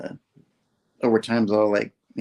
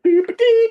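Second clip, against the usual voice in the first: "Dee-ba-dee" squeaked very high and loud; goofy sing-song; 8 dB louder than usual. Retold or imitated. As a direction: toot "Dee-ba-dee" like a silly cartoon jingle. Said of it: "He's like".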